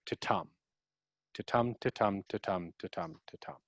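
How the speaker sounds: da-DUM rhythm, weak then strong, ta-tum each beat; iambic beat. Shown as a demonstration. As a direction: tap out the iamb, stress on tum. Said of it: "The I am is".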